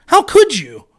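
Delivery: mock outrage. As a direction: burst out with the repeat.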